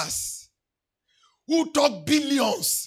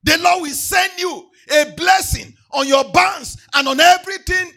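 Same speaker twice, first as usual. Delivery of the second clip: loud, chanting preacher's cry over music; thundering pulpit declaration of blessings; full-force preaching; 4 semitones higher, 9 dB louder than usual. Acted. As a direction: proclaim every blessing to the congregation at full force.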